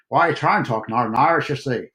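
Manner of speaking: jaw held still, Northern Irish accent. Shown as a demonstration. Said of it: "how to do an Irish accent".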